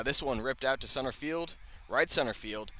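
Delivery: normal talking voice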